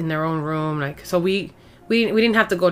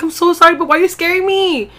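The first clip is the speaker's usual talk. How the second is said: high, loud panicked apology; frantic apology; 11 semitones higher, 8 dB louder than usual. Retold or imitated. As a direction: blurt it out shaken and alarmed. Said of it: "I was like".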